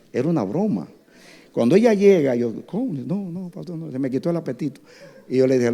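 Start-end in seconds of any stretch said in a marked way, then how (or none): 2.7-4.8 put-on upset girl.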